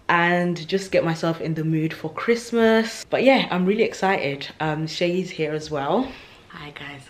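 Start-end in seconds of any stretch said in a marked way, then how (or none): none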